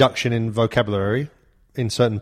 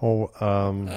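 ordinary talk, nothing marked

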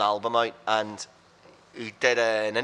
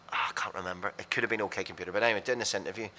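ordinary talk, nothing marked

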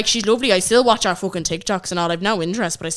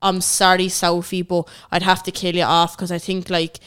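ordinary delivery

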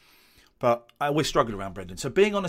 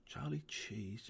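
ordinary talk

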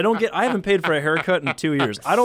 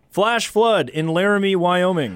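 news headline read; 4 semitones higher, 4 dB louder, much slower than usual. Shown as a demonstration. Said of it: "just ditch the first part and get to the news".

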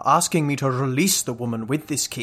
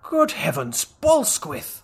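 Balsquith! cried much higher in astonishment; astonished; 4 semitones higher, 3 dB quieter, slower than usual. Acted. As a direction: gasp out the name in disbelief. General recognising Prime Minister.

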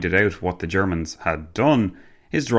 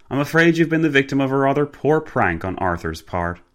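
higher-pitched gentlemanly voice; Alistair's genteel apology; 7 semitones higher, 3 dB louder than usual. Acted. Gentleman confessing the prank to the duped passenger, genteel. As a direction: explain it as an urbane gentleman.

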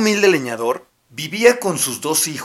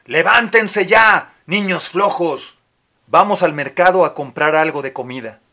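shouting stepmother; 3 semitones higher, 5 dB louder than usual. Acted. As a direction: bark the children out of bed. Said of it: "despertó a sus dos hijastros gritando".

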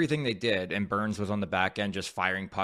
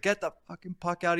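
much higher, half-mock yell at the players; a mock bench yell, pitched up; angry coach yell; 8 semitones higher than usual. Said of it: "He's yelling it".

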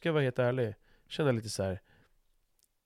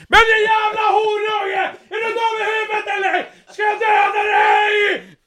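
screaming road-rage fury, much higher and louder; furious shout; 12 dB louder than usual. Retold or imitated. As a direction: scream at the driver in blind rage. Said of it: "eller så är det bara". (rasande vrålande bilist som skriker åt föraren)

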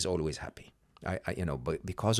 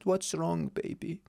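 put-on higher, gentle voice soothing a small child; mock comforting parent; 8 semitones higher, slower than usual. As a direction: coo to a hurt child like mommy.

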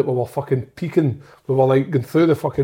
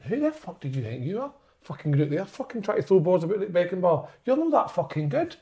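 imitated angry dressing-down; 3 semitones higher, 6 dB quieter than usual. Irate coach to player at half time, retold anger. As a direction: tear into someone up close, kept soft and rising in pitch. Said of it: "you've never seen a guy lose his head".

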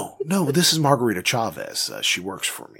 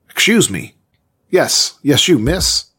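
calling out; 4 semitones higher, 9 dB louder, slower than usual. Acted. Calling out to a nurse.

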